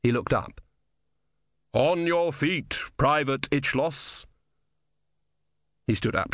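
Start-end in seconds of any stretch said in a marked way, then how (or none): 1.7-4.3 slow, drawn-out order, raised in pitch; commanding summons. Acted. Summoning a dead soldier to stand, slow commanding call.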